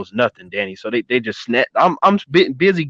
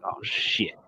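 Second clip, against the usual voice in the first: barely voiced, under the breath; hushed dread; 5 semitones lower, 13 dB quieter than usual. Teen realizing all eyes are on him, whispered. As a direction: let it slip out in a whisper.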